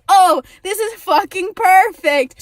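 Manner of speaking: loud, high, mock-delighted outburst; retold exasperated sarcasm. Patient told she needs an EpiPen, loudly sarcastic. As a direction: punch it up with bitter sarcasm.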